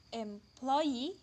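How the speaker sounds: employee with the main stress on the final -EE; stress on suffix. Shown as a demonstration. Lecturer illustrating stress-shifting suffixes, final stress on employee. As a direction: lean on the last syllable of employee. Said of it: "all take primary stress".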